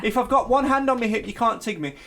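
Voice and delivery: a hint of childish protest; lightly voiced schoolchild. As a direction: insist on the rules like a kid playing tag. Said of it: "when you played tig at school and you say".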